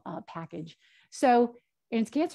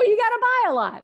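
high, loud, emphatic agreement; emphatic excited insistence; 14 semitones higher, 10 dB louder than usual. Retold or imitated. Coach urging a client to buy fruit, high and emphatic. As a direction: throw it back at her with big energy.

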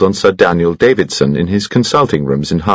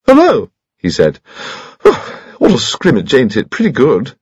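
put-on very high, piping chatty old gentleman; cheerful little squeaky voice; high-pitched Bunchy; 7 semitones higher than usual. Old friend greets Troy at the gallery, high piping chatter.

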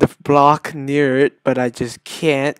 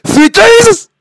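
high, loud exclamation; exasperated yell; 10 dB louder than usual. Real, not acted. Gamer shocked by another failure, exasperated yell. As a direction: cry out in exasperation. Fails in rage game.

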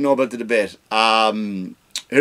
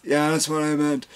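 mock Biden; 3 semitones higher than usual. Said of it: "Biden just went".

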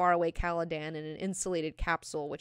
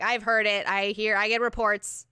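loud, higher brush-off; mock impatience; 4 semitones higher, 8 dB louder than usual. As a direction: voice an irritated royal cutting him short. Acts out Jessica snapping back.

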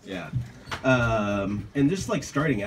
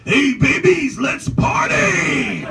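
high, shouted stoner party voice; Bixler voice; 10 dB louder, much slower than usual. Retold or imitated. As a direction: yell it like a wired party dude. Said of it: "basically Otto from The Simpsons".

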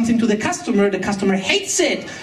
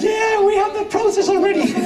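high, laughing voice of a knowing colleague; laughing mock reply; 10 semitones higher than usual. Imitating amused colleagues recognizing their own process, laughing high. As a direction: laugh the words out, mocking the familiar process.

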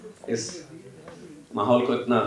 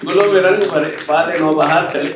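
higher, lilting shayari recital; reciting Faiz; 5 semitones higher than usual. Acted. Interviewer recalling Faiz for the author, recited verse.

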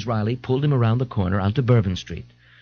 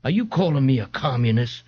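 policeman threatening; 6 semitones higher than usual. Acted. Policeman threatens old man.

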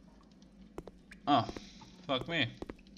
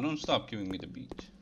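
ordinary talk, nothing marked

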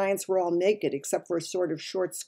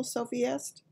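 ordinary delivery, nothing marked

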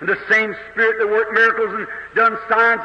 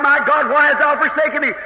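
ordinary delivery

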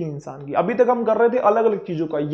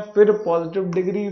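ordinary talk, nothing marked

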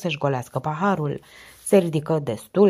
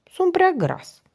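put-on higher, sheepish boy's confession; ashamed boy answering the inspector, higher voice; embarrassed boy; 13 semitones higher, slower than usual. A narrator's reading. Boy blushing admits being fat.

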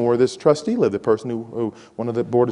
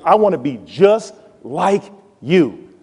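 admiring; slower than usual. Retold or imitated. Voicing an admiring freshman again.

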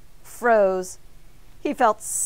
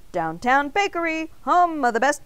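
phone greeting; 7 semitones higher, 6 dB louder than usual. A narrator's reading. Baker answers phone.

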